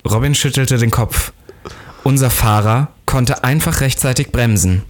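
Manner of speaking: plain reading voice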